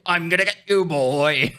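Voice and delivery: high, playful mock threat; taunting. Shooting people in a game, taunting. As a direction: taunt them in a put-on voice. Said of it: "wishing I could taunt them".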